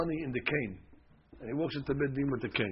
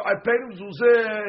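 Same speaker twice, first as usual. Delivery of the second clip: higher, louder mock claim of payment; wise guy claimant; 9 semitones higher, 10 dB louder than usual. Teacher mimics the tricky swearer, loud and high. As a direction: play the sly swearer insisting he paid. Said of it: "when you come along and say".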